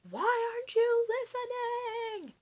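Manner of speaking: very high, slow, loud shout; king shouting very high and slow. Acted. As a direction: yell at the boy in disbelief. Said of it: "shouted the king".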